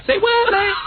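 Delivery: high, dumb put-on slang voice; playing stupid. Shown as a demonstration. Host demonstrating talking stupid in class, dopey slang voice. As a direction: ask it high, like a clueless slacker. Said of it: "you have to talk stupid".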